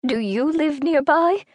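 put-on an old lady asking a stranger where she lives; curious old woman. A narrator's reading. Old woman asks Daphne.

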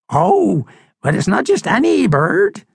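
high, swooping bird voice; old crusty seabird, knowing. Acted. Seabird corrects the doubting pig, high and knowing. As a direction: correct them with ominous relish.

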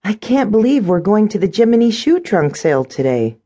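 put-on high, thrilled young heroine; almost squeal it, pitched well up; delighted, high-pitched disbelief; excited near-squeal. A narrator's reading. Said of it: "Cindy practically squealed".

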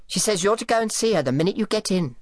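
high, fast, prim housemaid voice; primly. Acted. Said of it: "she said primly".

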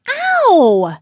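high, loud yelp of pain; pained yell. A narrator's reading. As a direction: yell out as if just bumped into. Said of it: "The boulder yelled".

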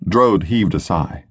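usual speaking voice

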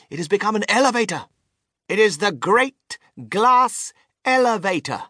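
high, emphatic inventor voice; showman inventor. A narrator's reading. Mister Wonka correcting a passenger about the lift, high and emphatic. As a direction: proclaim each point grandly.

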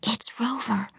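hushed, breathy whisper into the phone. A narrator's reading. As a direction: whisper it so nobody hears. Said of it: "she whispered into the phone".

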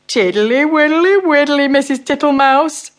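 slow, fat, comfortable toad voice; fat-voiced toad. Mr Jackson makes himself at home, slow fat toad voice. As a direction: settle in like a contented fat toad.

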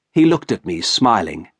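plain reading voice

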